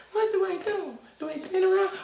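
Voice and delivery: usual speaking voice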